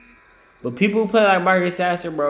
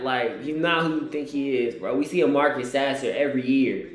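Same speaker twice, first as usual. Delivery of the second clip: ordinary delivery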